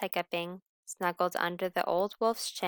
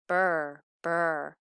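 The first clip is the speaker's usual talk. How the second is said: shivering brr; 4 dB louder than usual. Acted. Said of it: "shivering with cold".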